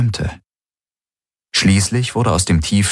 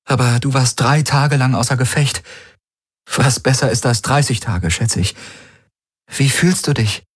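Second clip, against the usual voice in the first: higher-pitched, gentle female character; concerned woman companion; 6 semitones higher than usual. A narrator's reading. Kirai fills in her just-woken friend, gently concerned. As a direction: explain carefully to a patient.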